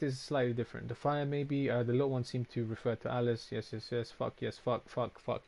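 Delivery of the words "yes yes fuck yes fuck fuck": ordinary talk, nothing marked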